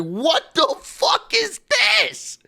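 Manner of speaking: high, slow, disbelieving shout; stunned shout. Retold or imitated. Recalling his live reaction to the kick-out, a stunned high shout. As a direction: yell it in total disbelief. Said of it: "I was like".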